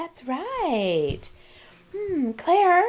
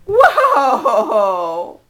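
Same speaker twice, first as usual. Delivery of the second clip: laughing whoa; 5 semitones lower, 7 dB louder than usual. Real, not acted. Laughs at child's move.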